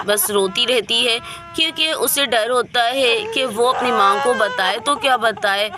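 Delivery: ordinary delivery, nothing marked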